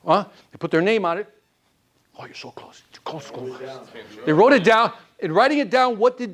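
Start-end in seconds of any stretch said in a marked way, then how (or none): 2.2-3.6 hushed teasing whisper to the class. Real, not acted. Teacher whispering a hint to the room, hushed. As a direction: whisper a hint, drawing them close.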